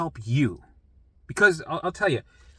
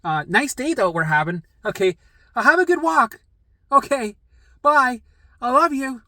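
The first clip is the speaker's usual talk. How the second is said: sugary, higher and louder goodbye; mock sweet; 5 semitones higher, 4 dB louder than usual. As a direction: overdo the niceness, half sarcastic. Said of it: "I could be like".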